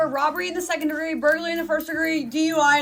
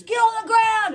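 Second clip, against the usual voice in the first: high, loud shouted command; acted police shout; 9 semitones higher, 7 dB louder, slower than usual. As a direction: yell it like a raiding officer. Imitates police officers bursting in.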